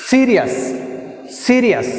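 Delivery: "Serious" with a high, forceful SE, then a fall; teacher modelling "Serious", stressed at the start; first-syllable stress. Shown as a demonstration. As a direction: push SE hard, drop the rest of "Serious". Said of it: "I am pronouncing more force".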